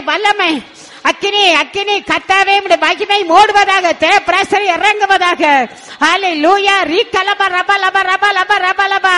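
loud, high, impassioned pleading prayer; fervent prayer. Real, not acted. Preacher begging God to touch everyone gathered, fervent full-force prayer. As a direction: cry out in urgent, repeated chant-like appeals.

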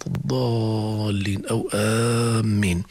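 "الضالين" and "آمين" with long level held aa; heavy long madd. Shown as a demonstration. Teacher recalls familiar examples, vowels stretched in "الضالين" and "آمين". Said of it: "كلمة مثقل يذكرنا".

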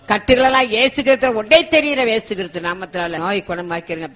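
loud, high, commanding prayer; forceful deliverance prayer. Real, not acted. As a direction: cry out with authority to drive out evil spirits. Prayer builds into casting out spirits. (உரத்த அதிகாரமான விடுதலை ஜெபம்)

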